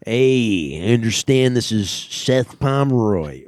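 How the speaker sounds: Ray Liotta impression. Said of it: "Ray Liotta wishing Seth happy birthday".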